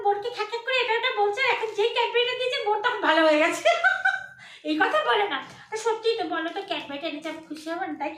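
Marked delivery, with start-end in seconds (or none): 3.7-4.3 short high laugh; a quick high giggle at the joke; burst of laughter. Real, not acted. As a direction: laugh at yourself. Laughing at her own turnaround.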